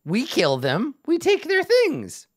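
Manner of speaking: a gleeful goblin listing its plan; goblin Yek. Acted.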